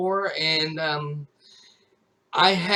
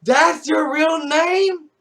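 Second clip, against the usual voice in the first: much higher, louder mock amazement; stunned chat viewers; 10 semitones higher, 6 dB louder, slower than usual. Viewers react to hearing his real name, mock amazement. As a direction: gasp it like a shocked fan. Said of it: "They'd be like".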